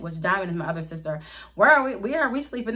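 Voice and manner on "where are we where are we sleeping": loud demanding whine. Retold or imitated. Sister demanding where she sleeps.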